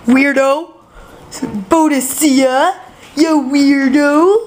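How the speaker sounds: loud high teasing voice; slow, teasing name-calling. Acted.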